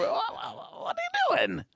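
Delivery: sudden high-pitched squawk; squeaky shout. Real, not acted. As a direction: yelp the question in falsetto. Reacting to partner's odd noise.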